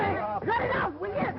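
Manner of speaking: high-pitched yell to stop; sharp, high cry of impatience; exasperated shout. Acted. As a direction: shout them down. Stopping the squabble.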